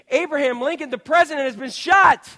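mock bulletin shouted to the crowd; alarmed announcement. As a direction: cry it out like a herald with terrible news. Pretend breaking news to congregation.

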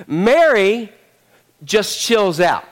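ordinary talk

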